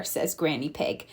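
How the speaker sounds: plain reading voice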